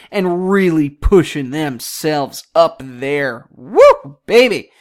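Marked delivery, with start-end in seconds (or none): 3.5-4.7 loud, high woo; excited cheer. Real, not acted. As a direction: whoop it up for the team. Cheering the Stars' streak.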